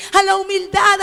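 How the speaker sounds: ordinary talk, nothing marked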